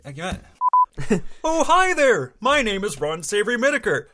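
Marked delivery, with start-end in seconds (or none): 1.4-4.1 high, hearty character greeting; Ron Savory Whitaker. Acted. Ron greeting listeners at his workshop, high character voice. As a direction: lift the hello up high. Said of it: "I'm Ron Savory Whitaker".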